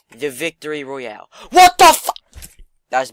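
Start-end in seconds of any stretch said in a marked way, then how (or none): 1.5-2.5 high, explosive yell of disbelief; startled shout. Real, not acted. Gamer watching his throw go wrong, shouting in shock. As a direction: burst out loud, cut off mid-word. Sudden outburst when gameplay goes wrong.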